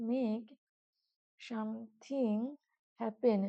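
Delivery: "make ... something ... happen" one word at a time, with long pauses; slow dictation. Shown as a demonstration. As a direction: softly stretch "something" between pauses, as if dictating. Teacher repeats definition word by word. ("make something happen" থেমে থেমে, ধীরে ধীরে বলা)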